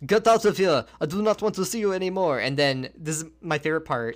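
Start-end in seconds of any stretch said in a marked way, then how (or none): 0.1-2.4 loud, high, indignant fake foreign accent; Vinovich's put-on accent. Host mimics the magician throwing Harry out, in a put-on accent. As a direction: dismiss them in a hammed-up foreign accent. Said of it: "breaks his accent".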